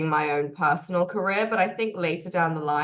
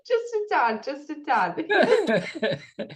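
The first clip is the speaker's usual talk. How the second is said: giggling, drawn-out just a tad; laughing; 9 semitones higher, much slower than usual. Real, not acted. Laughing as she teases Americans. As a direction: laugh through it slowly, teasing.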